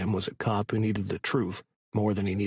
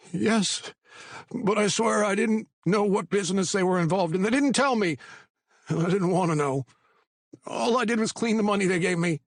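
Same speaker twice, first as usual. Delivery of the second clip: high, anxious pleading voice; a scared informant talking fast; nervous young man; 12 semitones higher, 4 dB louder than usual. A narrator's reading. Patty pleading.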